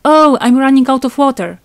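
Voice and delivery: high, loud put-on innocence; blurted excuse.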